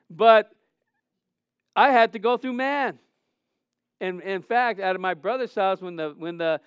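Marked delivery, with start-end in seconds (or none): 0.1-3.0 preacher's shout. Acted. Sermon climax cry.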